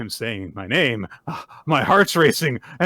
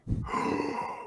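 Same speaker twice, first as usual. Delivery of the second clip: high, soft startled gasp; hammed-up little gasp; mock gasp; 9 dB quieter than usual. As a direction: gasp as if shocked by own tears. Jura crying, retold scene.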